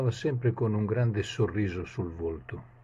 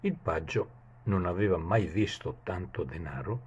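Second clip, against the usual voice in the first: plain reading voice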